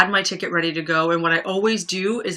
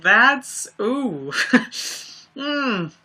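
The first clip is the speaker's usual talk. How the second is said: ordinary talk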